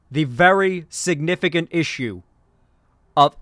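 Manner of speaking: emphatic politician impression, broken by weighty pauses; Bernie. Candidate conceding a point on firearms, emphatic Bernie impression. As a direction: deliver it in punched chunks like a stump speech. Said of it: "I'm doing a Bernie impression".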